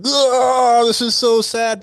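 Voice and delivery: high, loud hammed-up "oh so sad"; exaggerated high mock sympathy; mock sad reaction. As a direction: play your own over-the-top pity.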